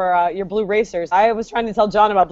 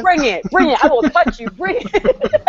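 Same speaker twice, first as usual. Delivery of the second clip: higher-pitched mock taunting, breaking into laughter; scrappy snake; 5 semitones higher than usual. Snakes talking in her head, playful fighting taunts. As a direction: goad them like a tiny tough guy. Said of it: "That's how they sound".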